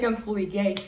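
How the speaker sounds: ordinary talk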